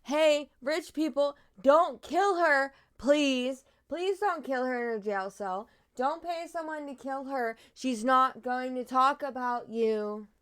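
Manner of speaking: loud pleading to rich people; loud mock begging to the rich; mock plea. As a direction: beg them loudly, half joking.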